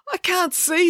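hurt and unable to see; distressed. Acted. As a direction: Joe crying out after being hit. Joe's eye hit by cane.

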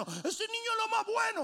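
put-on high sing-song praise of the child; mocking doting parents. Preacher mocks church parents praising a child, high sing-song. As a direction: lift it way up, mocking the proud parents. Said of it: "Todo el mundo diciendo".

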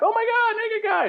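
high-pitched scandalized bystander; mock shock. Imagined bystander spotting a naked guy, mock shock.

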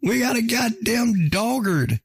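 high-pitched, drawn-out disbelief; hammed-up astonishment. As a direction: exclaim it high, stretching the last words. Acts out astonished reaction to bark.

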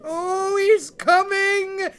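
high, loud, slow squeak of panic. Acted. Frightened husband squeaks to wife, high panicked. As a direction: squeak it out in terror. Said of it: "squeaked Finn".

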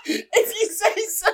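squeaky high-pitched mimicry, laughing; mock high voice. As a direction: pitch way up like a shy girl. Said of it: "The octave on your voice went too high".

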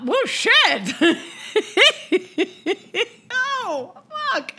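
high laughing exclamations; recalling the download count, laughing; laughing shock. Real, not acted. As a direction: laugh through the surprise.

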